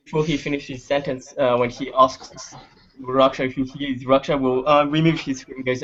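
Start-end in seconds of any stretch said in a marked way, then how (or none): none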